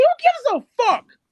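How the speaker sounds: high, loud, drawn-out retort; real angry yell, stretched out; exasperated outburst. Real, not acted. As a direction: burst out in high-pitched exasperation. Exasperated retort to mimicked complaint.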